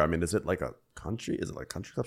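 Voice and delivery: timid whisper. Retold or imitated. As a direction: murmur it unsure. Voicing timid driver.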